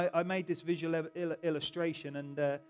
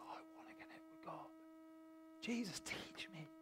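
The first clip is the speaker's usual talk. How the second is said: near-whispered yearning prayer; hushed longing plea; 16 dB quieter than usual. Acted. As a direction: plead very softly, as a disciple longing for God. Voices disciple's longing prayer.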